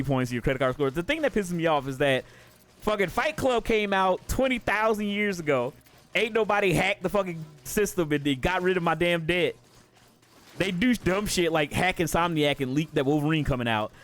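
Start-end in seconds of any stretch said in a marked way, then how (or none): none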